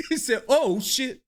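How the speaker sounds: high-pitched, laughing retelling; real laughing. Real, not acted. Host repeating grandpa's slip, laughing. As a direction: laugh your way through the quote. Cracking up at played clip.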